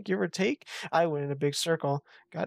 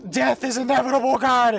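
high, loud proclamation; dramatic shout; 7 semitones higher, 7 dB louder than usual. Acted. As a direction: bellow it like a doom announcement. Mock-dramatic outcry while playing.

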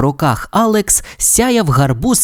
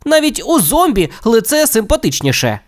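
high, exasperated grumble, mildly acted; irritated complaint; 9 semitones higher than usual. A narrator's reading. Steve throwing the carver aside, irritated complaint. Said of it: "роздратовано вигукнув Стів".

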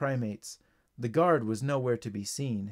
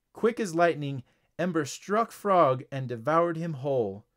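plain reading voice

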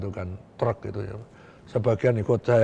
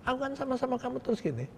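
higher, softer, coaxing; reassuring; 13 semitones higher, 7 dB quieter than usual. Retold or imitated. Official reassures the wary rebel, higher and gentle. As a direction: gently win his trust.

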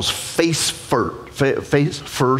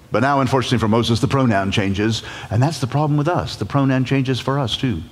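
ordinary talk, nothing marked

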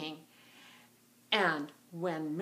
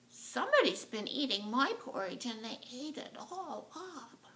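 higher little bear voice; small wee bear; 5 semitones higher than usual. Acted. Wee bear finds porridge all gone, small plaintive voice. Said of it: "little, wee bear said".

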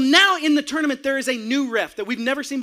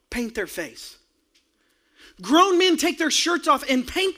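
rising preaching; 3 semitones higher than usual. Acted. Pastor preaching to the congregation about passion, building to a shout. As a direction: build the sermon to a roar.

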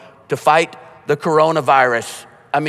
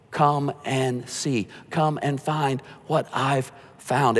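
soft, slow, inviting; gentle invitation; 6 dB quieter, slower than usual. Shown as a demonstration. Pastor gently inviting a doubter to come and see. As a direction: softly welcome them in, slowly. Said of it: "preacher's gentle invitation".

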